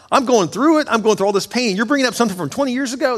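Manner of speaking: high, indignant objection, quickening at the end; protesting. Acted. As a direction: object in disbelief, pitched well up. Voices a hurt listener's objection.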